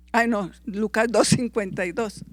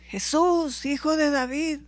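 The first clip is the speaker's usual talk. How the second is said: raised, higher-pitched cry, lightly acted; demons crying out; 7 semitones higher than usual. A narrator's reading. Preacher voicing demons crying out to Jesus, raised high. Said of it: "los demonios gritaron".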